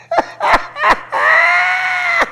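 real high-pitched laughter. Real, not acted. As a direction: burst out laughing at the joke. Laughing at own joke.

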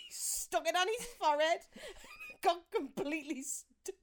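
high, loud words breaking into laughter; real laughing fit. Real, not acted. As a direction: crack up while telling the story. Cracking up at own story.

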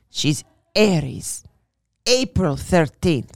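slow, put-on Italian guide accent; hammed-up Italian accent, slowly; bad Italian accent. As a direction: drag out "April thirteenth" in a mock Italian accent. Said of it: "it wasn't a great accent".

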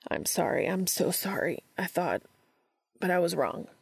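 mild anguished pleading. A narrator's reading. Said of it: "the anguish in his voice".

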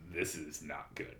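low, slow, hushed quote; son's deadpan verdict. Retold or imitated. Son to dad about [name] albums, quiet disapproval. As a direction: give the kid's verdict quietly and slowly.

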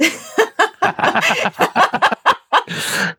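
real laugh, high and loud; high burst of laughter. Real, not acted. Host laughing at asking the guest about NFTs, loud high laugh. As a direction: break into a loud high laugh at your own joke. Laughs at own NFT question.

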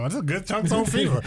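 high voice, lightly imitating daughter; playful high mock of the daughter. Imagining daughter at Thanksgiving.

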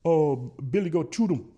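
high, loud Cajun swamp hunter; high-pitched Cajun holler; Cajun catchphrase impression. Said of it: "his catchphrase is".

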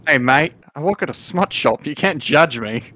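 defensive Australian koala. Acted. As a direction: push back as an affronted koala shopkeeper. Plays Australian koala shopkeeper.

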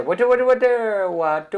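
put-on very high, loud Indian-accented hawker call. Man showing what you hear coming down the bridge, loud Indian-accented call. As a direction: blare it like a looped recording, Indian accent. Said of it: "pero con un acento indio".

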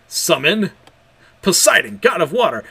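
ringing battle summons; summoning shout. Acted. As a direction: call the god up with full force. Summoning spell called out.

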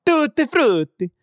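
high, lilting mock Italian; Italian accent. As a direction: exclaim it like a stage Italian. Plays an Italian saying it.

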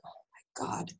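breathless, exasperated oh my God; whispered mock exasperation; eye-rolling groan. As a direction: sigh it out like an eye-roll. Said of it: "And I rolled my eyes".

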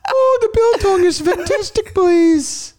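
very high, hooting mock falsetto; off-kilter Mrs. Doubtfire. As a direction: mimic the bad accent as a squeaky screen nanny. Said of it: "a weird off Missus Doubtfire".

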